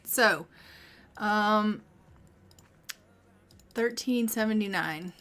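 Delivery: ordinary talk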